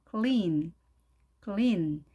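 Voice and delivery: clean with long spread EE and clear L; lips spread wide. Shown as a demonstration. Teacher contrasting with Konglish, clean with long EE. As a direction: stretch the lips for kl-EEN in clean. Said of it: "입술이 옆으로 쭉 늘려진".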